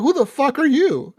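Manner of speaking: high-pitched incredulous question; mock-indignant demand to a stranger; retold baffled challenge. Retold challenge to unknown caller.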